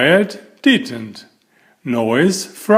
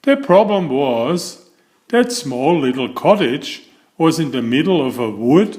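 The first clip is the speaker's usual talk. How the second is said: usual speaking voice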